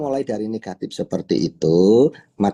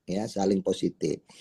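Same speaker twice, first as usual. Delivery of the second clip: ya, saling positif repeated much softer and lower; soft echo; 3 semitones lower, 8 dB quieter than usual. Shown as a demonstration. Teacher repeating the principle to the audience, quiet echo. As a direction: drop it to a quiet confirming echo.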